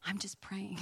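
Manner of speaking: hushed innocent whisper; whispered protest. Retold or imitated. Visitor answering the temple priest in a whisper. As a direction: whisper back an innocent excuse. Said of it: "I'm like".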